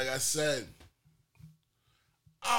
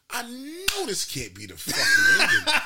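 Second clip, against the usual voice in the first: high, slow, loud exclamation; acted-out cry of protest; outraged disbelief; 13 semitones higher, 5 dB louder, slower than usual. Acting out disbelief at the ending.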